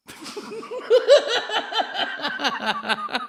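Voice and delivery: long, high, loud cackle; loud laugh. Real, not acted. Comedian cracking up at own botched line, long high laugh. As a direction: crack up loudly at yourself.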